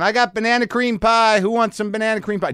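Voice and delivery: gleeful high pitch calling out to the line; excited offer. Retold or imitated. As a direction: announce the pie like a party host. Ryan enters offering pie.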